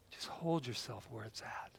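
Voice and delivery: hushed whisper; calming whisper to the crowd. Acted. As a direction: breathe the words out softly. Soothing instruction.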